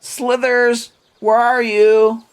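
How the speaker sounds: put-on high little-girl voice, calling out; little halfling girl searching for her snake; halfling child calling. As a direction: call for your lost pet like a small girl. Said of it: "you see her going".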